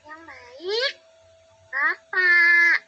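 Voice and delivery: put-on high, slow small girl's voice; little girl Cici. Child Cici. (suara anak perempuan kecil)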